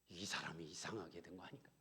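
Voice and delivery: hushed whisper of suspicion; whispered thought. Retold or imitated. Husband sobering up, wondering if his wife went strange, whispered. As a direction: wonder under your breath.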